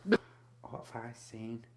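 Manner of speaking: normal talking voice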